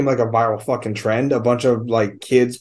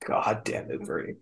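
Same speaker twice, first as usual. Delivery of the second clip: hushed curse; 5 semitones lower, 15 dB quieter than usual. Acted. Mock curse at a movie character.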